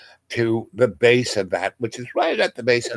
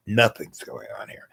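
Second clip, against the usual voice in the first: hushed unvoiced whisper; breathy secret aside; 5 dB quieter than usual. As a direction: whisper it so the cop can't hear. Quick cover to the cop.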